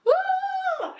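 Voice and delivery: imitating a long high scream; mock terrified shriek, held; retold scream. Recalling both screaming at each other in the hallway, long high shriek.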